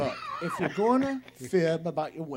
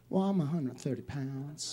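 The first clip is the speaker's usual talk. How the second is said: put-on Southern accent; 3 semitones lower, 4 dB quieter than usual. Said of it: "in her beautiful Southern accent".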